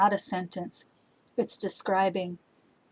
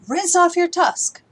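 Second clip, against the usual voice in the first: much higher, louder, slow, hitting off; emphatic command; 10 semitones higher, 8 dB louder than usual. Shown as a demonstration. Teacher contrasts the mild and the exclamatory command. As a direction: shout the order across the room. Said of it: "especially those imperatives like".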